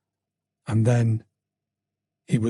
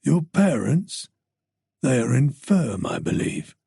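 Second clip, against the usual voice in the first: veiled threat. Acted. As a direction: menace by implication. Stalker voiced by narrator.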